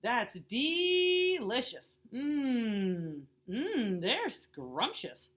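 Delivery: slow, loud, relishing voice; savouring. Acted. Child praising daddy's pancakes, slow loud relish. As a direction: drag it out like tasting something wonderful.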